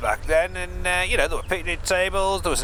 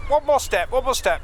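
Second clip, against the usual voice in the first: urging; 8 semitones higher than usual. Acted. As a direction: coax her one step back. Urging her toward the cliff edge.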